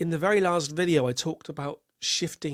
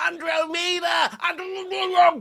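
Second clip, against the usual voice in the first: a far higher, slow, desperate cry from the waves; calling from the water; 4 dB louder than usual. Pretend. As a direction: yell out from the sea as if drowning. Drowning, crying out from the waves.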